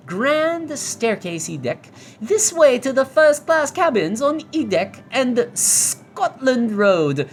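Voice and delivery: hammed-up ship announcement in a put-on accent; grand first-class announcer. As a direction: read the sign out like a pompous period guide. Reads signs in the put-on voice.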